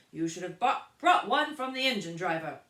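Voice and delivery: loud stern scolding; stern guard. A narrator's reading. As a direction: tell her off firmly. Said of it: "said the guard".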